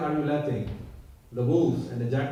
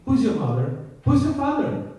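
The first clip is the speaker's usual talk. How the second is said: taunting; 9 semitones higher, 5 dB louder than usual. Retold or imitated. Child Balaram teasing Krishna.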